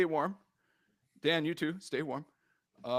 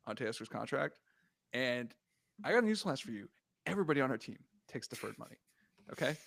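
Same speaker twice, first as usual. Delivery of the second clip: ordinary delivery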